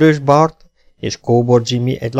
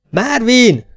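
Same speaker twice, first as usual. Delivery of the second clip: high, loud screeched call; screeching call; 13 semitones higher, 5 dB louder than usual. A narrator's reading. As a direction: screech out to a far-off rider. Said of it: "rikácsolja elveszett hangjának kiáltására".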